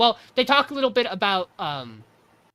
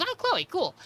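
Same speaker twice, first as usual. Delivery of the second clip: jumps much higher on Oh, Chloe, cool; suddenly getting it, in a much higher voice; a much higher, surprised note of recognition; surprised realization; 11 semitones higher than usual. Retold or imitated. Meg realizes Chloe is calling.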